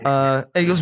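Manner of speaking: ordinary talk, nothing marked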